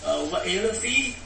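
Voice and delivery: high, incredulous retort, slowing at the end; indignant Micah. Retold or imitated. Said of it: "And he says".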